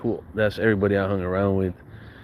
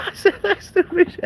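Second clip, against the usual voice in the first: laughing. Real, not acted. Laughing at own story.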